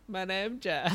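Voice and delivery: high goofy "My name Jeff"; meme voice. Retold or imitated. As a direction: pitch it up like the meme. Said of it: "and I'll be like".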